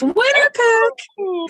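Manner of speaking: high excited cry of winner pick; excited shout. Real, not acted. Player hyping her pick to the group, high and excited. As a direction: announce it with glee, pitch way up.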